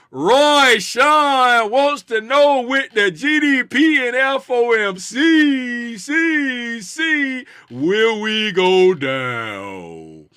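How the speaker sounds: slow, a touch drawn-out mystic; mock fortune teller asking the crystal ball; mock mystic question. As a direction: ask it like a séance.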